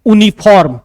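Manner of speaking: यूनिफॉर्म said "OO-ni-phorm", with no Y; mistaken reading. Shown as a demonstration. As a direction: start यूनिफॉर्म on a bare "oo". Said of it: "तो आप गलत कर दोगे".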